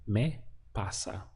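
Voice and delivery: slow, careful Spanish model: "Me pasa" drawn out syllable by syllable; teacher slowing down "Me pasa" so students can copy it; slow model answer. Shown as a demonstration. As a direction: lay out "Me pasa" slowly for learners to copy. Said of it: "So how would you say that".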